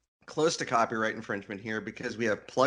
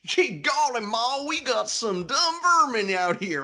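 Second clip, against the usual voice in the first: hammed-up Southern American drawl, pitched high; Southern American accent; 12 semitones higher, 4 dB louder, slower than usual. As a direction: play a dim Southern American yokel. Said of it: "a Southern American accent".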